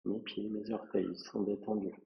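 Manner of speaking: soft, unhurried meditation voice with long pauses; guided relaxation. Acted. Yoga teacher guiding a student through a relaxing body scan, softly and calmly. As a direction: lead a quiet body scan, phrase by phrase.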